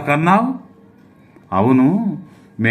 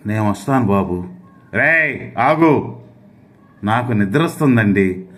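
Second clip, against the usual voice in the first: ordinary talk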